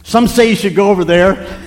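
high, loud imitation of an excuse-maker; mock objector. Churchgoer dodging confrontation, loudly mock-complaining about churches. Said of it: "they'll say".

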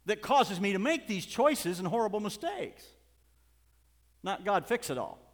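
loud, high, impassioned preacher; urgent preaching. Real, not acted. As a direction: cry out the confession with urgency. Preaches at full force.

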